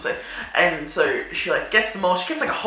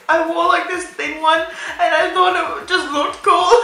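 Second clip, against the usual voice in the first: high and loud, half-laughing, half-crying; laugh-crying excuse to the teacher; 11 semitones higher, 3 dB louder than usual. Retold or imitated. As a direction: squeeze the excuse out through laughter.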